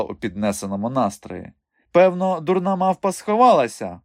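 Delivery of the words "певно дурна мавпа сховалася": high, raised lady's voice; dismissive circus madam. A narrator's reading. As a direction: brush the worry off in a high voice. Madam's light dismissive reply. (зневажливий високий голос пані)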